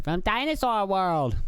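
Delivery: put-on high, drawn-out little boy voice; put-on high kid voice; little kid's answer. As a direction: imitate a small child, stretching it out. Acting his young son's answer.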